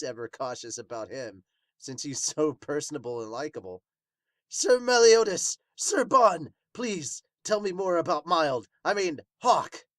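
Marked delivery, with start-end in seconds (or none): 4.5-9.9 high, loud, pleading fan voice; eager high character voice. Acted. A fan begs the knights for stories, high and loud. Reads a character's excited plea.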